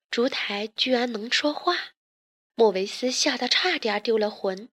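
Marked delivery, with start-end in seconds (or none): none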